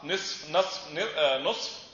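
نصف as nisf, nasf, hesitant nusf; three vowellings of nun. Shown as a demonstration. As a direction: switch the first vowel of نصف: i, a, then u. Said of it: "تجعل لها ضمة أو فتحة أو كسرة".

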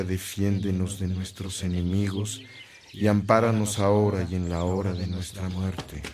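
solemn liturgical recitation; reciting a prayer. Acted. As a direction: pray it aloud like a priest leading the faithful.

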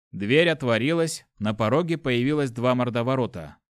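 usual speaking voice

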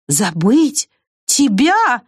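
incredulous two-part question, pitch jumping up; exclaiming. A narrator's reading. Squirrel reacts to being told they might forget, exclaiming. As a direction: exclaim in disbelief, lifting the second word high. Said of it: "воскликнула белка".

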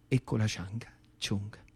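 whisper. Acted. Self-pitying list of his limp.

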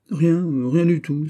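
hasty denial. Acted. Sailor caught under the porch, quickly denying. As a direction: brush it off fast.

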